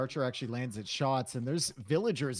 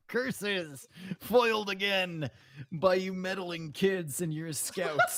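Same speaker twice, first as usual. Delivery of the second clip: slow, raised hammy villain voice; mustache twirling villain; 4 semitones higher, slower than usual. Retold or imitated. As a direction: ham it up as a foiled cartoon baddie. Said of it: "Is he a cartoon villain?".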